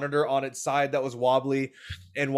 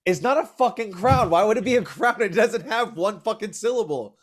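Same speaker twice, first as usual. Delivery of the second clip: high, louder comic indignation; exasperated outrage; 6 semitones higher, 3 dB louder than usual. Real, not acted. Friend objecting to crayon said as crown, exasperated. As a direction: protest it, pitched way up.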